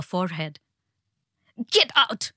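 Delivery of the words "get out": higher, louder shout, "Get out!" with stress on "Get". A narrator's reading. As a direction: bark "Get out!" as the first warning. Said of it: "her mom shrieked".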